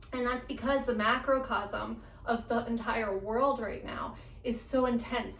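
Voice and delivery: usual speaking voice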